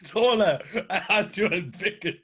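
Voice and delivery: slow, broken up by laughter; laughing. Real, not acted. Questioning a friend's logic about being taller, laughing. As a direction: crack up while asking.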